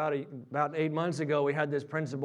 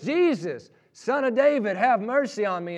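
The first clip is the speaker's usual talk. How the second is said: high, raised retold plea; acted-out beggar's persistent cry; yelling out; 7 semitones higher, 5 dB louder than usual. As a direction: keep crying out despite the crowd. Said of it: "he yells out".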